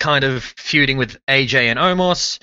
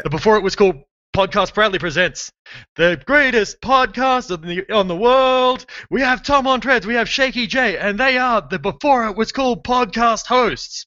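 booming circus ringmaster, pitched way up; 8 semitones higher than usual. Acted. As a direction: present the show like a circus ringmaster. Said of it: "Well, no, no, no, no. More like the".

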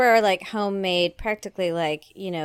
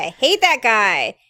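loud, punchy complaint; emphatic; 4 semitones higher, 10 dB louder than usual. Real, not acted. Cohost venting about a TV captain, loud. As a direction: spit out the dislike.

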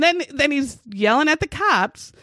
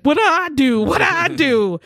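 mock frantic pleading; 5 semitones higher, 4 dB louder than usual.

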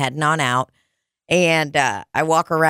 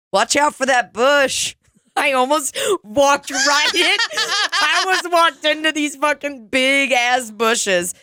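laughing through the story; 9 semitones higher than usual. Retold or imitated. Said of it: "he goes".

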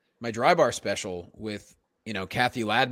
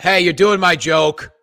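a louder, mock-blunt old comedian; gruff old comic; 3 semitones higher, 10 dB louder than usual. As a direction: lay down the law like an old-timer. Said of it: "he's gonna be".

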